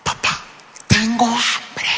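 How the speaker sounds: agudo y lento, voz de niña con hambre; niña quejándose. Retold or imitated. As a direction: lloriquea como niña hambrienta. Niña pide comida. (voz de niña quejosa y lenta)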